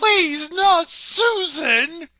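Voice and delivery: high, loud, slow melodramatic wail; wailing cry. Retold or imitated. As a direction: overact the grief, draw it out. Echoes the wailing quote.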